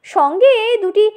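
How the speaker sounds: usual speaking voice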